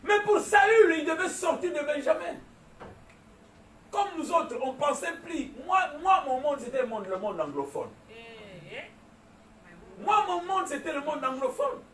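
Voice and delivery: high, loud, pounding sermon delivery; full-force preaching. Acted. Preacher rousing his congregation, shouting.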